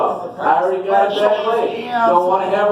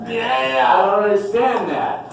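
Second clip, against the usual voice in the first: usual speaking voice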